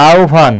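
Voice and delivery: আহ্বান as "ao-bhan", h turned to a vowel; demonstrated pronunciation. Shown as a demonstration. Teacher answering the class, আহ্বান sounded out as "ao-bhan". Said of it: "তাহলে এখানে কি হবে বলো তো?".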